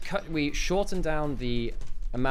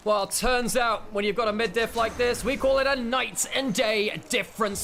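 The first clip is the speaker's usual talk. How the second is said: climactic play-by-play; 10 semitones higher, 3 dB louder than usual. Acted. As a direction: sell the game-winning moment. Caster calls the game-ending fight.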